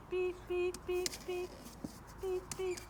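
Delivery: high, quiet beeping cue to the dog. Shown as a demonstration. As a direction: chirp the beeps softly and high, like a signal. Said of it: "when I beep, she backs up".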